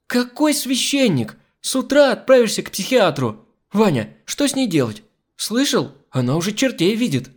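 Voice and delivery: high, exasperated scolding; outraged. Acted. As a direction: dismiss the idea indignantly. Outraged hostess rejects the priest. (возмущённо, высоким голосом)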